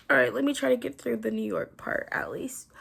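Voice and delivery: real whisper, sparing a sore throat. Real, not acted. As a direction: whisper it to save a strained voice. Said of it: "I'm already feeling strained talking".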